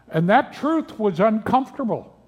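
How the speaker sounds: ordinary talk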